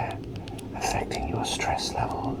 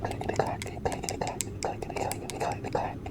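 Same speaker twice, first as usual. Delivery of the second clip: softer, fading clickety-clack whisper; whispered sound words; 5 dB quieter, much faster than usual. Acted. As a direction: chant the clatter under the breath. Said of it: "let's give it a go".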